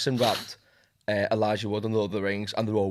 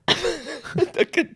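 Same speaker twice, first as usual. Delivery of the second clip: laughing squeal; 5 dB louder than usual. Real, not acted. Laughing plea to perform.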